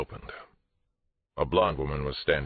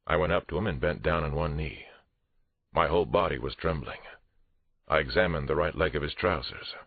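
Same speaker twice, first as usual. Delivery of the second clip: ordinary delivery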